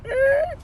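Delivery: very high squeaky creak; imitated floorboard creak. Next man steps on same wood, squeaky creak. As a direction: squeal like a loose board.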